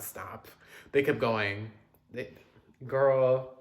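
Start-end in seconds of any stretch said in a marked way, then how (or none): none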